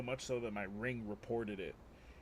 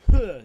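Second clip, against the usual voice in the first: loud sharp spitting huff, much higher; spit noise; 11 semitones higher, 20 dB louder than usual. Retold or imitated. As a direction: blast out a spitting huh. Spitting out cement in dream.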